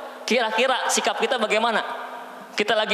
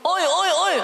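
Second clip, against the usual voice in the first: shouting; 12 semitones higher, slower than usual. Retold or imitated. Bedouin coming down the mountain, shouting at the group. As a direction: yell it down from the hill. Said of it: "petenteng-petenteng di gunung turun".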